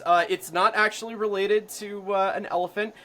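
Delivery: ordinary delivery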